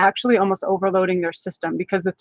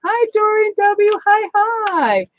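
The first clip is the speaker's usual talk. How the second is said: much higher, slow, gushing hi; excited greeting; 12 semitones higher, slower than usual. Real, not acted. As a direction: sing out the hello to a favorite viewer. Greeting a viewer live.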